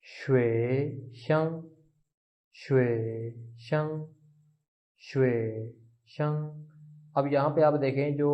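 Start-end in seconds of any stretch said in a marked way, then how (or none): 0.0-1.6 "श्वे शं" drawn out very slowly, श्वे rising and शं level; slow Chinese tones. Shown as a demonstration. Teacher showing students the Chinese tones, said very slowly. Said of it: "इसकी pronunciation कुछ यूं होगी". 2.6-6.4 "श्वे" lifted, then "शं" kept level, each syllable separate; Chinese syllables apart. Shown as a demonstration. Teacher drilling each syllable of the word separately. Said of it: "इसकी pronunciation कुछ यूं होगी".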